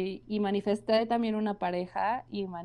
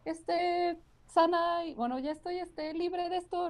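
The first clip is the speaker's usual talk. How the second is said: higher, mock-sweet voice; mocking naive voice; 9 semitones higher than usual. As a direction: parody someone claiming they're healed. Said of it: "no digas".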